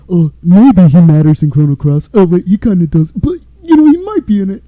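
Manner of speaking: high, loud sarcastic parody of the developers; mocking voice. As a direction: sneer it out as a silly excuse. Mocking the devs' excuse.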